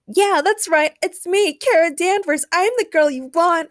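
put-on high, loud girl's call; shouting. A narrator's reading. Girl in the audience claims she is wanted, shouting. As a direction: call out eagerly from the crowd. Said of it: "girl with glasses in the audience shouted".

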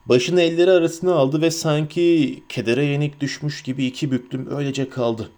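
usual speaking voice

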